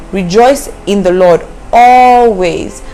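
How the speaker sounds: slow, weighty rejoice in the Lord always; emphatic verse. Shown as a demonstration. Preacher stressing the command, slow and weighty. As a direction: stretch out always. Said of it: "it shows the importance for it".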